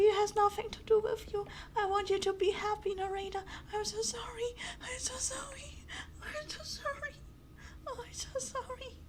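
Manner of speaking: high, soft tearful voice fading toward a whisper. Real, not acted. Player apologizing to the unhappy game narrator, tearful. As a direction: apologize through tears, voice thinning away. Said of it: "I'm about to cry".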